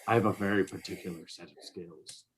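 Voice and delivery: low, quiet, menacing movie-threat voice; Liam Neeson. Retold or imitated. Mocking a co-host as Liam Neeson, low and quiet. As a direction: growl the threat low and soft, deadly calm. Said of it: "Liam Neeson being like".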